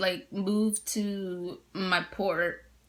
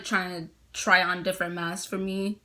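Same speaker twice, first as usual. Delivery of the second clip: ordinary talk, nothing marked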